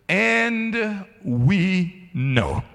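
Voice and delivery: AND WE KNOW drawn out very slowly, high; each word of "And we know" stretched and weighted; emphatic. Acted. Preacher quoting how Paul starts out, emphatic. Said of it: "this is emphatic".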